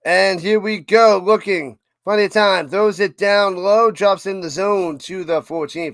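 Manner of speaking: fast, loud sportscaster call; play-by-play call. Acted. Streamer calling the game for chat, loud and fast. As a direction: call the run like a live broadcaster.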